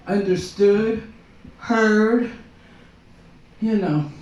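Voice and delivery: ordinary delivery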